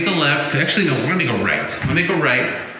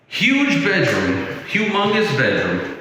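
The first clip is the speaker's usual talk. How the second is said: drawn-out, higher, wowed by the size; amazed; 6 semitones higher, slower than usual. Real, not acted. Touring the bedroom for viewers, genuinely amazed and drawn out. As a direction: stretch the first words out in awe.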